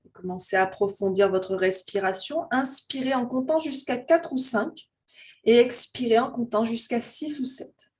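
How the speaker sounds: slow, calm voice leading a breathing meditation; guided meditation. Real, not acted. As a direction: guide them gently into their breath, unhurried, drawing out phrase endings.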